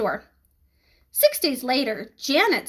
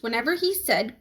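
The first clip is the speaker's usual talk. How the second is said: usual speaking voice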